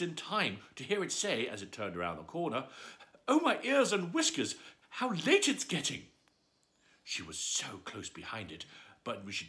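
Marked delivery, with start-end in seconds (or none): 3.3-6.1 high, loud panicky fuss. Acted. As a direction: fret as if already late.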